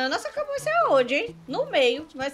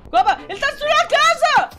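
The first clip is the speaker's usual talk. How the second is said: panicked yelling; 11 semitones higher, 5 dB louder than usual. Real, not acted. Monster destroying house.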